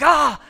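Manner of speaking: quick jump-scare yell; retold scare scream. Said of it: "and I screamed, and I was like".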